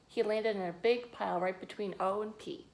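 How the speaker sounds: usual speaking voice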